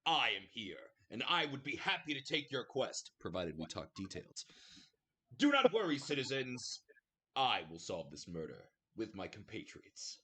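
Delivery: loud, high, booming proclamation; grand heroic reveal. Acted. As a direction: announce yourself to the crowd like a hero. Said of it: "Magnus will make his grand reveal".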